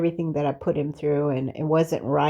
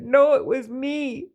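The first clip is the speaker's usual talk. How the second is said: retold firm contradiction, higher voice; arguing back; 6 semitones higher than usual. Woman quoting God's answer to her, arguing back. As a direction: emotionally recount the insistent answer. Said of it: "I was arguing with him".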